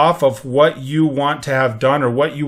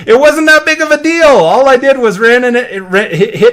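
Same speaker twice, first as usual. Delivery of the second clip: higher and louder, laughing it off; amused; 8 semitones higher, 7 dB louder than usual. Real, not acted. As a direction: brush it off with a laugh. Laughing off a minor mishap.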